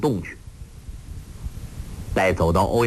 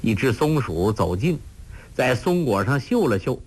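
ordinary delivery

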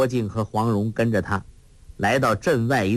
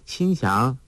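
ordinary delivery, nothing marked

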